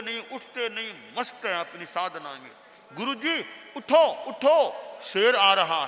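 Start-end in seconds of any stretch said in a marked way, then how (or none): 2.9-5.9 calls him louder, pitch up; urgent shout. Acted. Disciple shouts at meditating guru, urgent and loud. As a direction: shout to wake him, panic rising. Second louder wake-up call.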